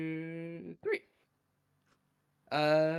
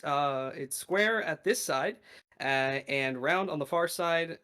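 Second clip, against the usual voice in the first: slow, measured scene setting; game master narration; 5 dB louder, slower than usual. Acted. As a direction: paint the train car slowly.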